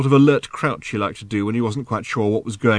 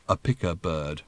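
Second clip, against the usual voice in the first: another voice; 5 semitones lower, 7 dB quieter than usual. A narrator's reading. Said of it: "said another voice".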